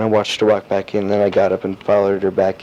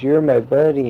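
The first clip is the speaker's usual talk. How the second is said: higher-pitched imitation of a small boy; lightly put-on child's voice; little kid; 6 semitones higher than usual. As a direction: mimic a kid claiming a buddy, pitched up. Repeats the little boy's quote.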